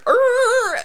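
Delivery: high-pitched imitation of skidding tyres; drawn-out high tyre squeal; screeching car noise. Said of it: "comes speeding into the car park".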